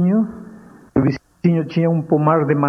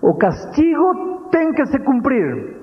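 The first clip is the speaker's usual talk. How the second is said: solemn mother; 9 semitones higher, slower than usual. Retold or imitated.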